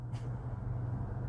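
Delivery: quiet airy laugh; a soft chuckle at his own joke; breathy laugh. Real, not acted.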